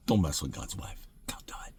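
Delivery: soft whispered don't mess with God's wife. Real, not acted. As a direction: lean in and whisper it like a secret. Ending the warning in a whisper.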